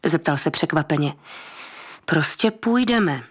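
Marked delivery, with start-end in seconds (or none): 2.1-3.2 ostře a pomalu, výš posazený hlas. Acted. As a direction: usekni to ostře a důrazně. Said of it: "zopakovala ostře". (ostře zopakované „Prostě půjdeme“)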